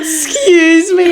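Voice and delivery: high, loud, drawn-out disbelief; mock-shocked. Customer answering the seller's innuendo, loudly mock-shocked. Retold shocked, delighted reply.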